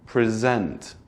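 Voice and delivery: "present" as the verb, pri-ZENT; second-syllable stress. Shown as a demonstration. Teacher saying it two ways, "present" with end stress. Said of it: "say it two ways".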